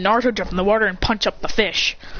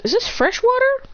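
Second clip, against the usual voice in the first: high curious question. Acted. Testing river water.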